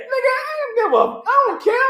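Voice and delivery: very high, laughing dismissal; laughing defiance. Retold or imitated. He dismisses boss, laughing high voice. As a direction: brush them off while cracking up.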